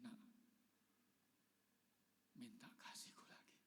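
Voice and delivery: low, soft whispered answer; gentle whisper. Retold or imitated. Pastor voicing God's tender answer to him, whispered. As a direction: voice God gently, barely above breath.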